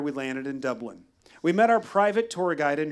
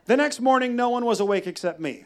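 ordinary talk, nothing marked

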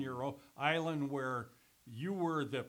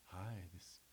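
imitating her voice; 7 semitones lower, 12 dB quieter than usual. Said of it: "she goes".

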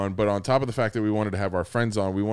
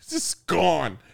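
ordinary talk, nothing marked

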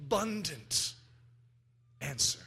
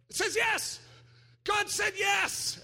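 much higher, shouted yes; excited shout; 14 semitones higher, slower than usual. Retold or imitated. Preacher voices the excited neighbour, high shouted joy. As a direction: cry out the yes in delight. Guy next to you celebrating.

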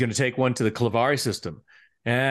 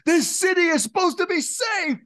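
sudden shout. Retold or imitated. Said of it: "he goes".

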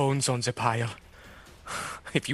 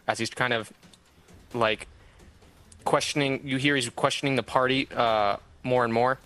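normal talking voice

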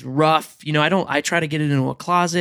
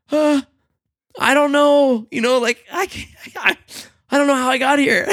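high flustered panic; 9 semitones higher than usual. Retold or imitated. Own panic, retold.

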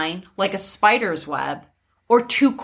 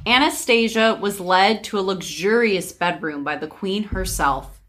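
usual speaking voice

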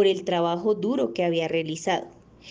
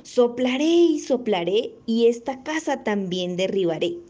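furious hungry wolf threat; 3 semitones higher than usual. Acted. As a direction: huff and puff with rising anger. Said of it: "el lobo, más enfurecido y hambriento". (lobo enfurecido y hambriento)

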